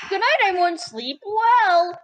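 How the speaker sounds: high, playful bedtime call; playful good-night call. Pretend. Player bidding everyone good night, high playful call.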